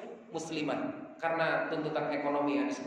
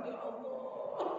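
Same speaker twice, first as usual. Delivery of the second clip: mock weeping prayer; 9 dB quieter, much slower than usual. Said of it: "kita udah nangis-nangis tuh".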